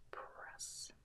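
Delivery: very soft whisper while concentrating; real involuntary whisper. Real, not acted. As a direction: whisper under your breath as you work. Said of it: "Sometimes I whisper when I concentrate".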